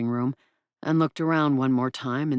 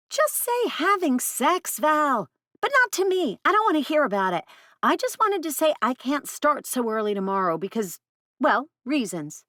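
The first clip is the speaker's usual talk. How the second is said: put-on high, squirming teen voice; embarrassed teen girl; 12 semitones higher than usual. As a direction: cringe and hurry past it. Teen voice on phone.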